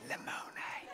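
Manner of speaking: a hushed whisper. A narrator's reading. Boys at a sleepover, whispering late at night. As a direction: whisper it like a secret. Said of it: "They're saying".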